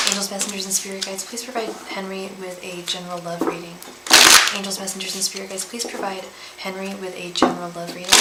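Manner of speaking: very soft, level prayer chant; hushed ritual recitation under the breath; murmured invocation. Real, not acted. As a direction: murmur it as a repeated incantation. Repeated invocation while shuffling cards.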